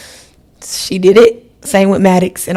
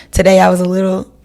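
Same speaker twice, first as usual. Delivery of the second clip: ordinary talk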